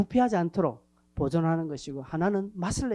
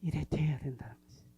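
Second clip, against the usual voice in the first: whisper; 5 semitones lower, 8 dB quieter than usual. Real, not acted. Whispered aside.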